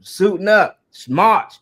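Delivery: loud, high sergeant shout, punching each order; drill sergeant. Retold or imitated. Military sergeant drilling a recruit, imitated hard shouting. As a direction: yell orders like you're breaking in recruits. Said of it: "They talk to them in that tone".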